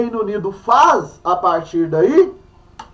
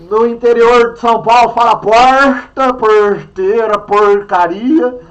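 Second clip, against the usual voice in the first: "porta", "porteira", "porcaria" with a strong retroflex caipira R, drawn out slowly; caipira rolled R; 5 dB louder, slower than usual. Shown as a demonstration. Teacher showing the São Paulo countryside R to students, slow caipira R. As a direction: stretch out "porteira" and "porcaria" with a heavy interior-paulista R. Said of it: "nós que puxa um R".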